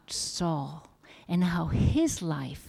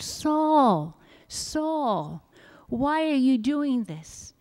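higher-pitched call from a heavenly voice; 7 semitones higher, much slower than usual. A narrator's reading. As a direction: call out the name, each one falling away. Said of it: "a voice called out".

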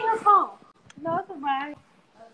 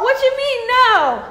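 raised mock outrage; 6 semitones higher, 5 dB louder than usual. Real, not acted. Mom repeating louder.

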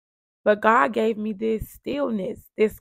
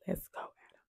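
hushed, barely audible retold whisper; urgent whisper; 15 dB quieter than usual. Mother telling Adam to leave the house, whispering. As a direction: whisper it so only the child hears. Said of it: "I do a whisper".

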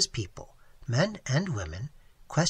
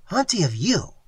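louder, insistent repeated question; imitated Seminole man; 4 semitones higher, 7 dB louder than usual. Waitress imitates Tigertail repeating his question, insistent. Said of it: "even imitating his voice and gestures".

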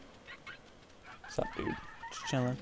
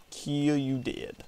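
slow mock menace; 3 semitones higher, much slower than usual. Drawn-out mock threat.

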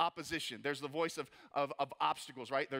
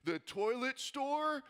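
mock trash talk; 7 semitones higher, slower than usual. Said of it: "the one guy's like".

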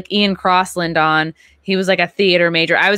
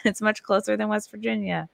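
relayed message; 5 semitones higher than usual. Retold or imitated. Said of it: "Anne was like".

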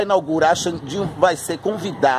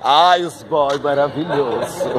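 swooning laugh; 4 semitones lower, slower than usual. Real, not acted. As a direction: drool over them with a giggle. Admira os rapazes passando. (suspiro encantado e risonho pelos rapazes)

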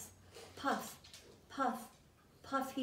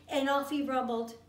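ordinary delivery

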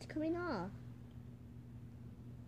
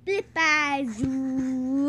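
silly drawn-out voice; 7 dB louder, much slower than usual. Shown as a demonstration. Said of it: "The way you said flip bazoo".

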